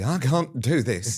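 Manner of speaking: hammed-up wail of defeat; mock despair. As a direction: imitate someone giving up, stretching the words. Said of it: "or you were gonna be".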